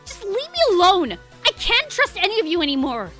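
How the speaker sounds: loud, high, hurt yelling; angry outburst. Acted. Dot lashing out at the agents, angry shout.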